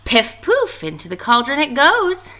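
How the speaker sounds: high, loud gleeful witch cry. A narrator's reading. Teacher reading, Naggy Witch tossing the tail in. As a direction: toss it in with glee. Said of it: "cried Naggy Witch".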